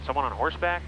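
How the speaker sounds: usual speaking voice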